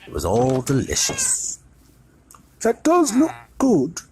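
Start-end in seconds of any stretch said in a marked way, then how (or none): 2.6-4.0 high, loud wistful ghost voice; longing for the food he can't eat; sad ghost. A narrator's reading. The ghost watches Harry eat steak, wistfully. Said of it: "said the ghost in the ruff sadly".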